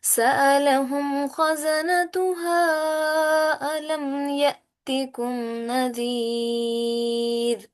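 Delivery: lovely slow recited verse for the class; Quran recitation. Shown as a demonstration. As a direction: chant each word long and tuneful. Teacher performs full tilawat.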